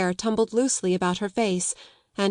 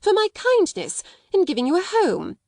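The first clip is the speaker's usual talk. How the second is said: indignant insistence; 11 semitones higher, 4 dB louder than usual. A narrator's reading. Headmistress demands gratitude.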